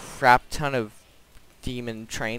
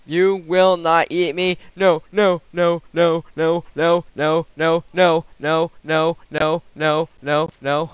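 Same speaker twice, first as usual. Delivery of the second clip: terrified horror victim; 4 semitones higher, 8 dB louder than usual. Real, not acted. Monster about to eat him.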